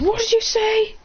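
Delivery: high-pitched mock outrage; indignant challenge. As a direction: fire the question back, pitch way up. Kiba offended by taunt.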